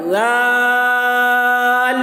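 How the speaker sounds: زال stretched very long with a thin sound; long held letter name. Shown as a demonstration. Teacher demonstrates زال for students, stretched and chanted. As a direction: chant زال slowly, tongue tip out. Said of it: "زال بھی دیکھیے".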